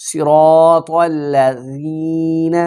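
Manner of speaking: watch the mouth and chant الذین gently; teacher's model, slow tajweed chant; slow recitation. Shown as a demonstration. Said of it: "میرے منہ کی طرف دیکھ کے پڑھیں".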